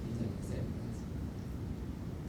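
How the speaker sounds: slow, barely-there whisper of a hope; whispered wish. Retold or imitated. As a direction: breathe it like a private prayer for the pupil. Repeats the wish inwardly.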